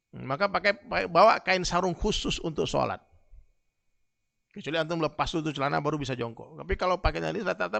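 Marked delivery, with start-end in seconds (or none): none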